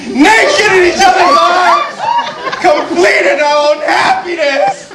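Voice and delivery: slow, drawn-out cry of bliss; ecstatic declaration. Acted. Husband recalls lovemaking, slow ecstatic cry. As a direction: build it into a drawn-out rapture. Climax of the love account.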